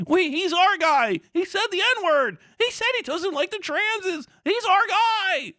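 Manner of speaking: high, slow dopey voice stretching our; high-pitched mock fan chant. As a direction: mimic a dim devoted fan, high and drawn out. Mocking fans quoting streamer.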